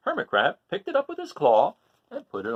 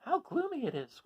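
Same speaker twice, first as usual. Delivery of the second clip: much higher, softer voice; whispering starfish; 13 semitones higher, 7 dB quieter than usual. Acted. Starfish in the dark forest, high and soft. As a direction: breathe it out high and small. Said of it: "whispered the starfish".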